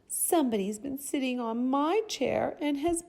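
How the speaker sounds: tiny, very high little bear voice; high, loud, cute cub cry; itty-bitty Baby Bear. A narrator's reading. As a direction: squeak it out like an upset baby bear. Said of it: "in his itty-bitty voice".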